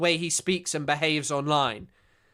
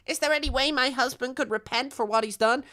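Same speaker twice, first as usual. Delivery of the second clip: higher-pitched imitation of a pleading wife; Carmela from The Sopranos; 7 semitones higher, 3 dB quieter than usual. Wife asking a lawyer about her husband, put-on higher voice. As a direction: play the wife in a raised voice. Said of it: "And she's like".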